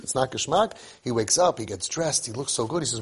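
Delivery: ordinary delivery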